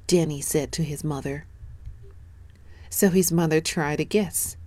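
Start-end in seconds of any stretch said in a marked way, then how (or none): none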